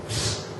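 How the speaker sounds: short hissed "psst"; sneaky attention-getting psst; quick, quiet psst; parrot's psst. Acted. Parrot signals to passing customer.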